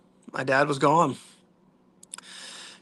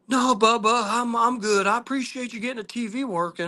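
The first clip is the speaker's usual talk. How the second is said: lightly voiced frail father, higher; frail dad's voice; 9 semitones higher than usual. Dad in hospice thanking his son, lightly voiced.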